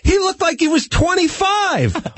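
high, slow stunned question; can't believe the number; shocked disbelief. Real, not acted. Shocked at the age.

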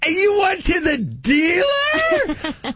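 high, slow, cracking cry of disbelief; shocked shriek. Retold or imitated. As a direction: screech the question like it's madness. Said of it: "my voice went up like twenty-eight octaves".

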